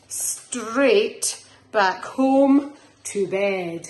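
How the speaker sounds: slow, drawn-out closing line; bedtime-story finish; slow ending. A narrator's reading. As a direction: land the last line slowly.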